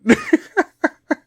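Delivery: high, loud burst of laughter; big giggling laugh. Real, not acted. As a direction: crack up at your own bit. Cracks up at own accent bit.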